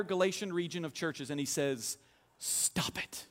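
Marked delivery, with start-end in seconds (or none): 2.4-3.3 a low, whispered stop it; hushed rebuke. Acted. Paul telling the Galatian churches to stop, hushed. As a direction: cut them off quietly but firmly.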